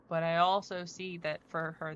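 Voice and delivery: ordinary talk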